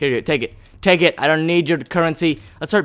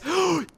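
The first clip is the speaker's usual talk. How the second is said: high, quick gasp of delight; sharp, high intake of breath; excited gasp; 13 semitones higher, 9 dB quieter than usual. Real, not acted. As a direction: gasp at a lucky find. Spots a chest with axe.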